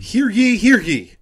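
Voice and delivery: loud, high proclamation voice; town crier. Acted. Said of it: "let me quote it verbatim".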